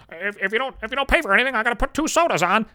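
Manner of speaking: high, nasal-free nerdy put-on voice; mock the haggling waiter demanding an order; geek waiter. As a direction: play a whiny pushy waiter. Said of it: "the fucking geek waiter with the buck teeth".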